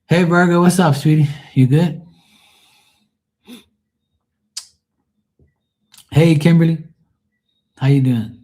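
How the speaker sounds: high, loud, friendly hello; calling out greetings. Real, not acted. Calls out greetings to arriving viewers.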